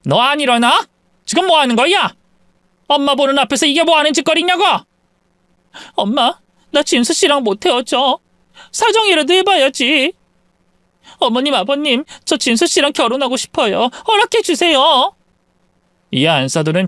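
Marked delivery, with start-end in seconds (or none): 0.1-4.6 higher, scolding mother voice; angry mother. Acted. Mother scolds daughter kneeling before in-laws, angry. 5.9-15.1 put-on higher, begging young woman; pleading daughter. Daughter begs in-laws for permission to marry, pleading.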